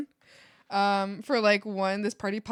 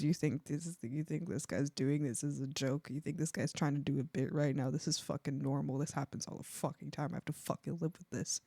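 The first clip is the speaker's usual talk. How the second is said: dog's inner voice; 9 semitones lower, 8 dB quieter than usual. Retold or imitated. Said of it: "the dog's telling the cameras".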